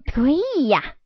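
high, delighted cry; overjoyed. Acted. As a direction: jump for joy. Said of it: "小犬春浪高兴得几乎跳起来".

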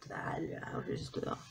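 whispered, teasing as if something creepy is watching; playful spooky whisper. Pretend. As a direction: breathe it out like a mock ghost. Spooky dark-makeup tease.

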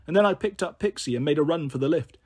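ordinary delivery, nothing marked

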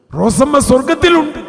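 preacher repeating the prophecy in a loud cry; triumphant proclamation. Retold or imitated. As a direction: shout the good news to the hall.

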